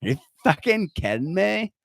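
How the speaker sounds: mock Valley Girl dreaming of modeling. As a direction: ham up a ditzy Valley Girl. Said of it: "You sound like a fucking Valley Girl".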